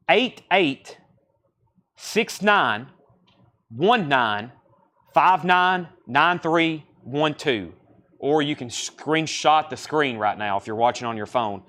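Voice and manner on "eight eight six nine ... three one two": digits read out slowly with pauses; slow, deliberate number readout for someone copying it down; slow dictation. Real, not acted. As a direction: dictate the number in small, spaced groups. Reading out barcode for viewers.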